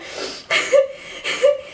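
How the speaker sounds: a breathy, high burst of laughter; airy high laugh. Real, not acted. Retelling James asking Lily out, airy laugh.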